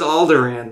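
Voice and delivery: "Alderaan" ending like "ran"; short A as in "cat" ending "Alderaan"; ANN ending. Retold or imitated. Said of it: "Someone says Alderaan".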